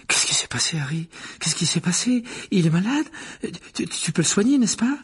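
high, breathless-eager questions; eager little boy. Acted. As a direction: pester with questions, voice rising. Colin, excited kid, asks questions.

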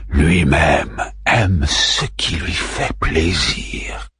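low, slow, monstrous voice with a cry breaking in; Caliban's voice. A narrator's reading. The monster Caliban musing on his god, slow and eerie. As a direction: drag it out like a brooding monster, wailing then yelling. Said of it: "La voix de Caliban résonna".

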